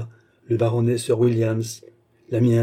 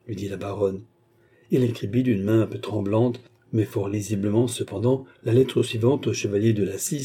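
ordinary delivery, nothing marked

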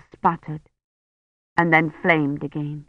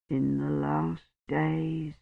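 slow, much softer, thick-tongued words; blurred dying voice; 2 semitones lower, 10 dB quieter, slower than usual. A narrator's reading. Dying Roche speaks to Kivrin, slow, soft and blurred. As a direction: speak through a swollen tongue. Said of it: "his voice blurred by his swollen tongue".

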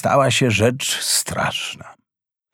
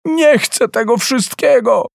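much higher, defiant cry; shouting; 11 semitones higher than usual. Acted. Boy shouting down to his mother, much higher defiant cry. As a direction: refuse her, shouting down from above. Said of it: "krzyczał Mrille".

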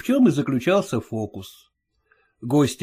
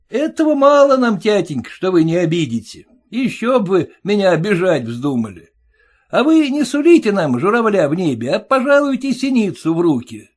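a high, pinched voice, louder, needling the father-in-law; mocking groom; 6 semitones higher, 4 dB louder than usual. Groom taunting his stingy father-in-law, high and mocking. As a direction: jeer at him in a sing-song.

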